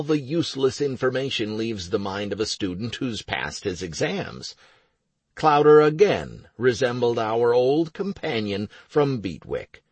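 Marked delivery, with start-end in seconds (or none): none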